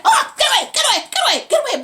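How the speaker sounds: high, loud repeated shooing; panicked shout. Retold or imitated. Villain to attacking pit bull, panicked shouting. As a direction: yelp it out to fend off a dog. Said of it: "And dude is like".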